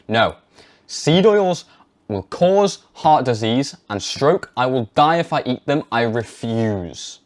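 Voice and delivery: slow, firm and emphatic; with conviction. Shown as a demonstration. Demonstrating the confident way to talk to parents, firm and slow. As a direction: hammer each claim home. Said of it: "Say that to your parents".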